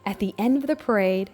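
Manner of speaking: plain reading voice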